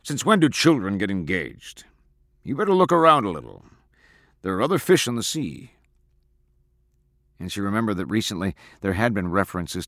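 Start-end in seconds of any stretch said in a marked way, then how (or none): 0.0-5.7 mildly scornful father, higher at the end; lightly voiced dismissive father; snorting scorn. A narrator's reading. Father dismissing his daughter's engagement, mildly scornful. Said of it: "he snorted at her".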